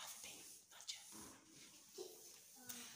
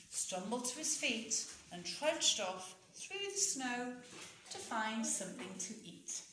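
ordinary delivery, nothing marked

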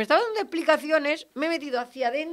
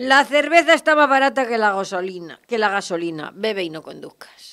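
joke read out; 4 semitones lower, 5 dB louder than usual. Shown as a demonstration. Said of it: "me acaban de mandar una cosa muy graciosa".

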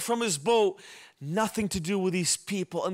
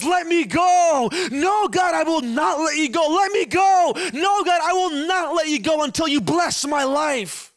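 wrestling shout; 9 semitones higher, 6 dB louder than usual. Acted. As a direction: shout it as if grappling with God. Jacob wrestling with God.

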